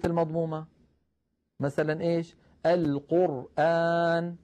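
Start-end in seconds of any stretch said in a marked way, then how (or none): 2.7-4.4 القرآن drawn out slowly with a thick, rounded ra; slow Quranic القرآن with a heavy ra. Shown as a demonstration. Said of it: "نعاملها معاملة رو".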